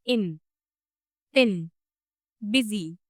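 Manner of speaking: इन, थिन, बिजी with a quick loose short i; teacher showing the short lax i; short relaxed i. Shown as a demonstration. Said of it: "ये थोड़ा short है और relaxed होता है".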